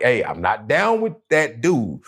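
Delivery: ordinary talk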